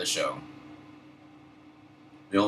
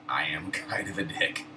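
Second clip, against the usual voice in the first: ordinary talk, nothing marked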